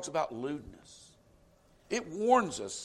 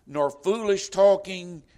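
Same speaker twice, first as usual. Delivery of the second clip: "talking" stretched slow and loud with a falling close; solemn scripture reading; 3 semitones higher, 9 dB louder, slower than usual. Acted. Preacher reading the verse aloud to the congregation, slow and louder. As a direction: proclaim "nor foolish talking" like a verse from the pulpit. Scripture quoted, then repeated plainly.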